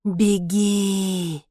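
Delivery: drawn-out eerie call; faraway voice urging flight; distant voice. A narrator's reading. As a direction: call as if from far away. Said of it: "она услышала далёкий голос".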